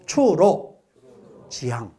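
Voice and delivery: measured chunks with pauses between; leading a repeat-after-me. Shown as a demonstration. Lecturer having the audience repeat the phrase, slowly chunked. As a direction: say "추, 노, 추, 로, 지, 향" in separate pieces to echo. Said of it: "그래서 따라해봐".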